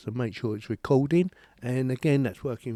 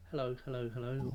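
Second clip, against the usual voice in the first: much softer, as if away from the mic; far from the mic; 12 dB quieter than usual. Shown as a demonstration. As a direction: call hello from well back off the mic. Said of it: "sometimes I might go".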